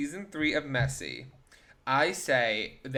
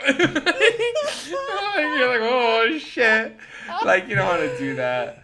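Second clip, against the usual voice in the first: high, loud laughing yelp; 8 semitones higher, 7 dB louder, much slower than usual. Real, not acted. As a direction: cry it out between laughs. Laughing at the gross kiss.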